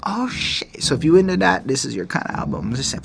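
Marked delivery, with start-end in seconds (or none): 0.1-0.8 high, soft awed reaction; awed realization. Retold or imitated. Listener thinking over the bars, awed realization. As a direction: let it dawn on you, hushed and high. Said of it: "Like, you sit there going".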